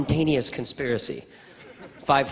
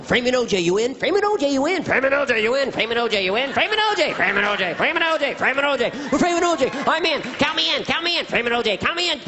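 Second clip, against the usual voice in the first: high, rapid-fire voices passing word along; excited chain of recruits chiming in; eager conspirators; 9 semitones higher than usual. Acted. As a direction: pass it down the line in high, punchy bursts. Acted rumour passed along cops.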